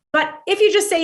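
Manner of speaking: ordinary delivery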